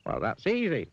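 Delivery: high, confident figure voice; Mister Dizzy. A narrator's reading. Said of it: "replied Mister Dizzy".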